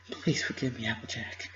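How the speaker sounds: soft, pleading apology; begging softly. Acted. Twilight softly begging Applejack to forgive her. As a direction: beg quietly for forgiveness. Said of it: "Twilight begged".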